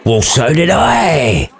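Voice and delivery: slow, hammed-up retort; a touch of a snapped comeback; mock snap. Mate snapping back at Romeo, slow mock retort. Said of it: "snapped back one of his mates".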